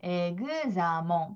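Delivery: examen stretched out very slowly; syllable by syllable. Shown as a demonstration. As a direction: break examen into slow parts. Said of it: "빨리 해서 examen".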